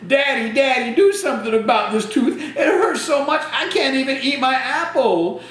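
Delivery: a hammed-up kid whining about a sore tooth; whiny little boy. Andrew complaining to Daddy.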